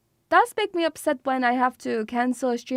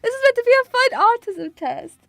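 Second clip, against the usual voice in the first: high tearful apology; mortified and weepy; embarrassed, crying; 9 semitones higher, 5 dB louder than usual. Real, not acted. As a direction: apologise in a flustered high voice through tears. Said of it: "I'm so embarrassed".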